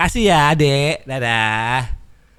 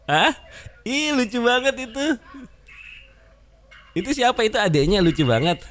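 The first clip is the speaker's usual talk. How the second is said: gushing; 10 semitones higher than usual. Real, not acted. Gushing over cute kid. (memuji anak lucu dengan gemas)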